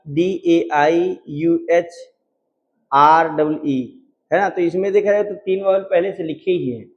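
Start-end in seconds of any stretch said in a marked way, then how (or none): 0.1-3.6 very slow letter by letter spelling; डीएआईयूएचआरडबल spelled letter by letter, very slow. Shown as a demonstration. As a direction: spell each letter out slowly. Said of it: "देखो लिखा है".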